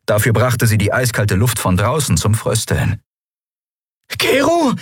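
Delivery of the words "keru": high, strained call; high croaky cry; croaking. A narrator's reading. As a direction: croak out his name from smoke. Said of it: "krächzte sie".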